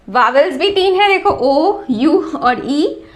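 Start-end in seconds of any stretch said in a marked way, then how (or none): none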